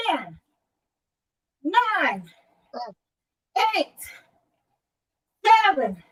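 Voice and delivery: upbeat rep countdown, count it out; aerobics instructor. Real, not acted. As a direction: call the count like a fitness coach. Counting, faster pace.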